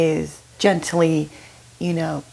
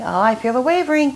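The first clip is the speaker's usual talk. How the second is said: heightened mock glee; 7 semitones higher, faster than usual. Pushy person surer of the wavering, gleeful teasing. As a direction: pitch it up as the weakness grows. Same line escalated, higher.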